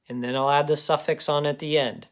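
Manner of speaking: ordinary delivery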